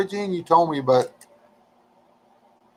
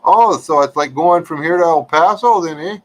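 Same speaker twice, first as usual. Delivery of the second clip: put-on a playful Minnesota accent, higher and louder, ending on eh; 6 semitones higher, 5 dB louder than usual. Asking a Canadian viewer about distances in a mock Minnesota accent. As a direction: ham up the Minnesota accent with a long Oh and a rising eh. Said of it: "Ah, that was Minnesota".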